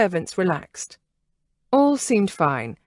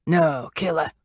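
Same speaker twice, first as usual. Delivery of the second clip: gravelly order; 3 semitones lower than usual. Acted. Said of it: "the leader ordered".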